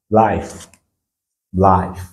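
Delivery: "life" with i said as eye; English I sound. Shown as a demonstration. Teacher repeats an example for learners, "life" with eye vowel. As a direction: model "life" alone, vowel gliding like English I. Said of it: "on a dit, c'est I".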